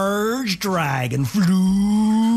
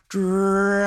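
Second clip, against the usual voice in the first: calling "Dragon" a bit louder; a little louder call; 3 semitones higher than usual. Acted. Elizabeth calling the sleeping dragon, a little louder. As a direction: raise the voice a notch on "Dragon". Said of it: "Says a little louder".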